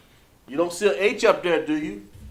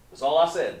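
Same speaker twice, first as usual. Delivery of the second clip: ordinary talk, nothing marked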